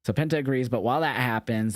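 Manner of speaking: normal talking voice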